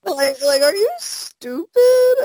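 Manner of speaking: high, drawn-out, laughing disbelief; incredulous laughter. Real, not acted. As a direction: squeal it slowly through laughter. Mocking fans, cracking up.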